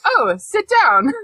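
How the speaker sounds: put-on polite British boy. Podcaster imitating Harry welcoming the elf, slow and polite. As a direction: politely offer a seat. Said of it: "a polite British boy".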